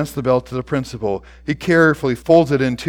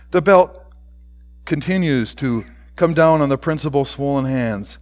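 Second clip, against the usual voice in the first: plain reading voice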